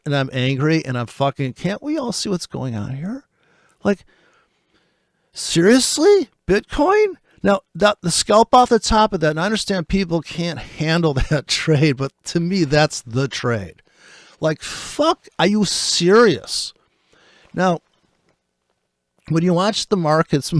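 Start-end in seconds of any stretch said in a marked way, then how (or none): 3.8-7.2 much higher, disbelieving squeal; can't believe what Bitcoin is doing; high-pitched disbelief; incredulous. Real, not acted. Incredulous at market. 14.4-16.7 exasperated disbelief. Real, not acted. Disbelief at market.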